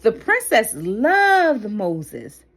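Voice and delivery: ordinary delivery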